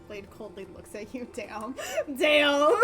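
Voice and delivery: ordinary talk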